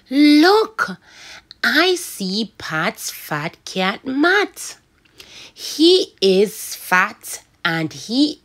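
slow, lively storybook read; expressive read-along story; reading to children. Shown as a demonstration. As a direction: read it big and slow for little ones. Reading story aloud again.

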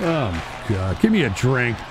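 low, soft, worn-out plea; defeated groan. Real, not acted. Streamer begging for a drink, defeated and low. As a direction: sound beaten down.

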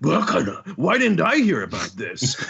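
high-pitched acted disbelief; startled villain. Kanjuro caught unawares, startled disbelief. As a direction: start high on the gasp, stay indignant. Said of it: "Kanjuro's like".

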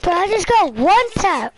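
high, loud excited shout; hyped gamer bro. Real, not acted. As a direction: yell it, thrilled by the hit. Hyped after a gaming hit.

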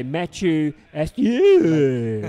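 hammed-up French accent, last name loud and drawn out. Shown as a demonstration. Commentator coaches co-host on a name, exaggerated French accent. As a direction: put on a big mock French accent. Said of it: "say it with a French accent".

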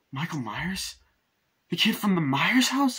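high, rising acted disbelief; incredulous question. Laurie learns who was after her, incredulous. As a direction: shoot the pitch up into a question.

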